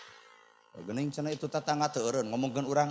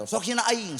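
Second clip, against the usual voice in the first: pitched higher, a taunting mock challenge; higher mock taunt: "hina aing" tossed up and out; mocking dare; 7 semitones higher than usual. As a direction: throw the dare out like a cocky heckler.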